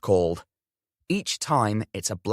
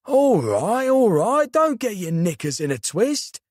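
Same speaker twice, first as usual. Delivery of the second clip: high, loud South London geezer brushing it off; a cheeky South London uncle, much higher and louder; South London accent, telling his nephew to calm down; thick South London accent; 9 semitones higher, 4 dB louder than usual. Acted. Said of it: "A thick South London accent".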